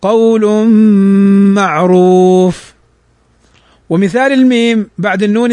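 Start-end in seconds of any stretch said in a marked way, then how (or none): 0.0-2.7 "قول معروف" slowly recited, the noon merged into the meem with ghunna; slow Quranic recitation of "قول معروف"; tajweed recitation. Shown as a demonstration. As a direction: draw out "معروف" slowly and nasally. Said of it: "تنطق هكذا".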